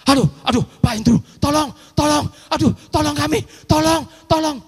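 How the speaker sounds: high, loud desperate begging for help; panicked plea. Retold or imitated. Husband begging the preacher by phone, high and panicked. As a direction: cry out to the pastor for rescue.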